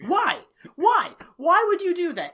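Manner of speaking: loud, high, repeated demand; baffled exasperation. Real, not acted. Co-host demanding sense of a pointless scene, exasperated. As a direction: hammer out each question in disbelief.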